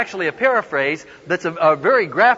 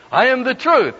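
higher, ringing proclamation; confident, raised-voice recitation; loud declaration; 7 semitones higher, 4 dB louder than usual. Retold or imitated. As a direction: announce the prompted words boldly to everyone. Boy loudly repeats whispered prompt.

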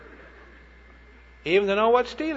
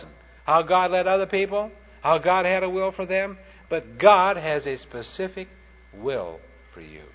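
ordinary talk, nothing marked

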